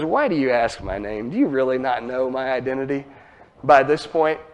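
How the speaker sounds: a quiet, amused reply to Jacob; gentle teasing question. Retold or imitated. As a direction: ask it softly, with a smile. Man answers Jacob with a smile.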